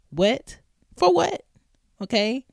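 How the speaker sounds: high, loud disbelieving what; baffled, can't believe it; incredulous. Real, not acted. Podcaster baffled by anniversary celebrating, high incredulous.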